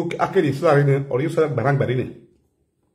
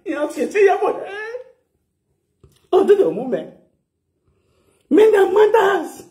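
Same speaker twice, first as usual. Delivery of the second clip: squealed repetition. Real, not acted. Squealing about the matter.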